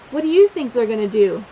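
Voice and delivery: ordinary delivery, nothing marked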